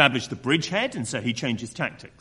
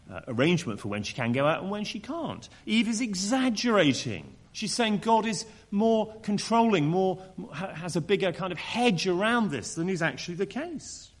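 ordinary talk, nothing marked

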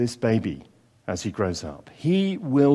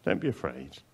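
much lower and much softer, hushed comfort; the angel calming a frightened Mary, soft and low; reassuring angel; 10 semitones lower, 12 dB quieter than usual. Acted. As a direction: whisper the comfort down to her gently. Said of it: "the angel reassures her".

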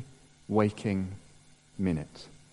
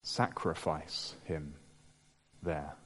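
scripture reading; 2 semitones lower than usual. Acted. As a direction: read the passage gravely, letting each phrase land. Preacher reads the Genesis passage.

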